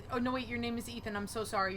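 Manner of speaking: normal talking voice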